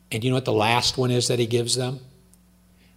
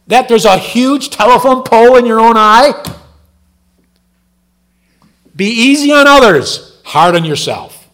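much higher, much louder, impassioned sermon voice; fiery preaching; 10 semitones higher, 14 dB louder than usual. Acted. Preacher rebuking the congregation about blaming others, shouting. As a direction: preach it hard, almost shouting at the crowd.

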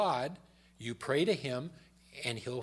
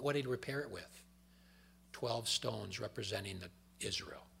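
ordinary talk

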